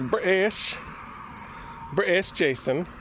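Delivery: a lightly clipped put-on British "British Jason"; mock British accent. Shown as a demonstration. Vlogger poking fun at British Jason, mock British accent. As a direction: tease the friend's British accent, half-joking. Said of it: "Eh, I can't be doing that".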